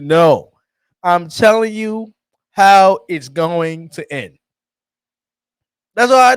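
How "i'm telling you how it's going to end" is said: ordinary talk, nothing marked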